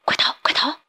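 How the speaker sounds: urgent, frightened whisper; little blue fish whispering to rainbow fish to run. Acted. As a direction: whisper in a panic. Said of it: "对彩虹鱼耳语道".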